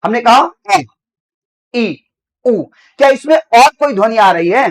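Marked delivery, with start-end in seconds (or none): 0.7-2.6 अ, ई, उ said as pure, separate vowels; vowel demo. Shown as a demonstration. Teacher modelling vowels अ, ई, उ for the class. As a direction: model अ, ई, उ crisply. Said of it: "ऐसे कहा".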